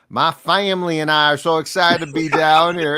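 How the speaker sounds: mock a coach's new fake Southern accent. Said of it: "and you never had an accent".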